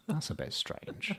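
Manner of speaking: hushed, high, drawn-out puzzlement; retold baffled murmur. Man puzzled by his date's answer, baffled murmur. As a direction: say it softly and slowly, thrown by it.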